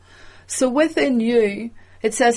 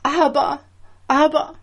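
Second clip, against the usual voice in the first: crying out; 6 semitones higher than usual. Acted. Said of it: "up in this cry unto the Lord".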